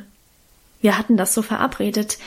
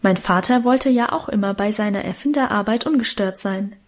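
plain reading voice

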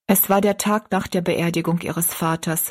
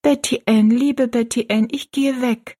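put-on higher, urgent girl's voice; confiding girl; 6 semitones higher than usual. A narrator's reading. Rosalie telling her friend she is leaving, high and earnest. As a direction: confide in a raised girlish voice.

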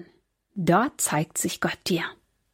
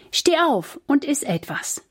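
higher-pitched, mildly urging command; urging angel; 6 semitones higher than usual. A narrator's reading. Angel rousing the sleeping Elia to eat, mildly urging. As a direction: gently prompt him, voice lifted. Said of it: "forderte der Engel Elia auf".

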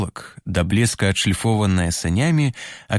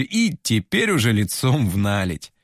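ordinary delivery

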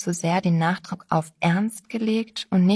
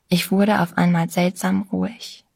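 ordinary delivery

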